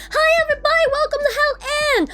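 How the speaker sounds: excited welcome. Real, not acted. Streamer welcoming viewers to Hell End, high and excited. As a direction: call out a big show-opening welcome.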